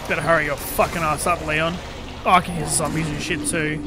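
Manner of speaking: loud, pressing urging; tense shout at a game character; urgent. Real, not acted.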